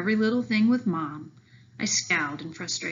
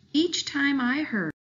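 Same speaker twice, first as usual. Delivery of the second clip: plain reading voice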